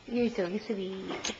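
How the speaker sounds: soft, slow, hushed endearment; tender coo. Real, not acted. Parent coos tenderly at toddler, slow and soft.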